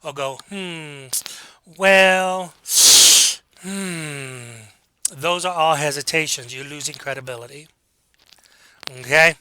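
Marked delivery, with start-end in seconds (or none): 0.5-4.4 drawn-out "Hmm, well, hmm" with long gaps; hesitant vocalized pauses. Shown as a demonstration. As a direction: stall on "Hmm", stretch "well", trail into "hmm". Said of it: "a hesitation could be a vocalized pause".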